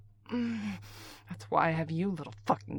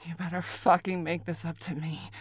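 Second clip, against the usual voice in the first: ordinary talk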